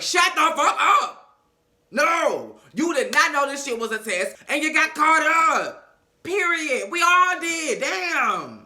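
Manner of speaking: high, loud scolding yell; mock outraged shouting at him; furious yelling. Group yelling at Stu.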